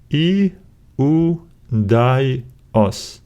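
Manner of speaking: "I, U, di, os" split by clear pauses; syllable by syllable. Shown as a demonstration. Teacher breaking a Greek word down, syllable by syllable. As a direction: say "I, U, di, os" one syllable at a time. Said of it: "Now let's break it down".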